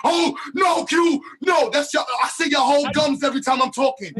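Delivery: rising into loud mocking shouts; building from scolding to shouting; heated mocking rant. Real, not acted. Heated mockery at distracted brother.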